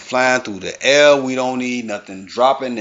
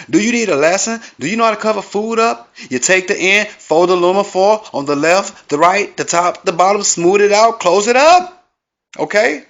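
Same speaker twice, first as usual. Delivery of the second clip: higher and louder, building; exasperated lesson; 7 semitones higher, 4 dB louder than usual. Acted. Scolding a greedy guest with a mock tutorial, rising exasperation. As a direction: lecture like a fed-up teacher.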